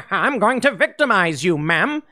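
hammed-up menacing criminal with a laugh; a cartoon bad guy, lightly voiced; mock villain. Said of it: "this guy would go".

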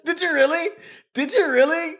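high, delighted laughing question; laughing disbelief. Real, not acted. Host laughs high at the guest's old claim. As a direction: squeal it out in amused disbelief. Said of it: "laughing disbelief".

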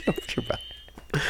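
breathy giggle through the words; laughing whisper. Real, not acted.

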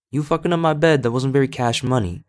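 sharp, high and loud scolding; scolding the dog. Real, not acted. Speaker scolds her dog Phoenix for wrecking the bed, high sharp scolding. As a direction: tell the dog off, exasperated.

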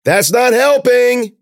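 loud, high, drawn-out shout; stretched-out, frustrated holler; calling out. A narrator's reading. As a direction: yell it across a distance. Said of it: "Ben called".

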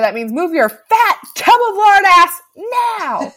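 high, loud, slowed exasperated snap; exasperated outburst. Retold or imitated. Gail finally exploding at slow cameraman Kenny, exasperated. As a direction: lose patience at the end.

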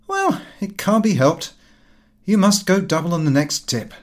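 lively son's voice, pitched high and fast; a brash young man breaking bad news breezily. A narrator's reading. Son telling father the shares collapsed, brash and high.

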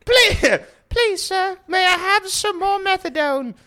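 high, loud, tearful pleading orphan; Oliver Twist. Retold or imitated. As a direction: wail the begging like a starving urchin. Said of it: "Oliver Twist".

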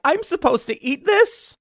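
high mocking disbelief at the food; mock whiny camper. A Gen Zer in the mountains, high mock disgust. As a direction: do a spoiled kid staring at his plate. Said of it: "before they're like".

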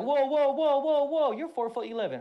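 startled whoa. Retold or imitated. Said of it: "I'm like".

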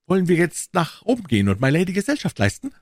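high-pitched lord making a suggestion; einladender Lord. Acted. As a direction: invite them upstairs in a high host's voice. (hohe Lordstimme, höflich fragend)

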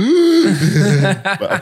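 a sudden loud, high gasp of laughter; laughing gasp. Real, not acted. Laughing gasp mid-story.

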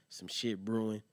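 ordinary talk, nothing marked

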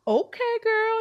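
put-on very high, delighted okay girl; impressed. Mother impressed by daughter.

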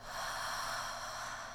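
soft drawn-out exhaled sigh; long slow sigh. Shown as a demonstration. Host leading listeners in a celebratory sigh. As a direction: breathe out one long sigh. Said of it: "long, slow sigh to celebrate".

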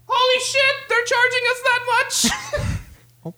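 high, slow, laughing shock; shocked king. Acted. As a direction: squeal in disbelief at the bill. King reads a shocking bill.

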